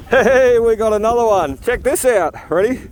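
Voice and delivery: thrilled shout of discovery; excited. Real, not acted. Finds a coin, calls out.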